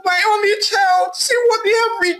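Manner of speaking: high, crying lament; a mother sobbing at her son's state; weeping Madam Itoha. A narrator's reading. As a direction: wail over your only child. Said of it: "Madam Itoha wept".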